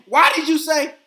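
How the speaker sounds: high, loud confronting cry; angry demand. Retold or imitated. Wife confronting her husband when she comes home, loud angry demand.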